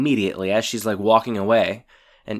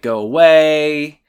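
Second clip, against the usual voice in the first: drawn-out, louder mock dismissal; calling through door; 4 semitones higher, 6 dB louder, slower than usual. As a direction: call it out slowly, a bit higher. Girls shout through closed door.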